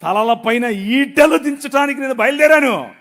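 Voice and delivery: loud, solemn, proclaiming; God's majestic proclamation over music. Retold or imitated. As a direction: declare it like a king marching out.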